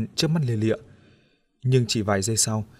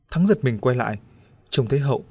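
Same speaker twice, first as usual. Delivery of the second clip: usual speaking voice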